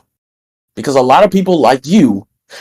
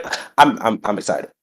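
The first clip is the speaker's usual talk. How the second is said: "I'm, I'm excited" much softer and low, no lift at all; flat, unexcited; 4 semitones lower, 7 dB quieter than usual. Real, not acted. Sick wrestler admits low energy, flat deadpan. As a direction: say you're excited with none of it in the voice. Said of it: "If you don't hear the excitement in my voice".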